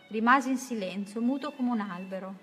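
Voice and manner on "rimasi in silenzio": plain reading voice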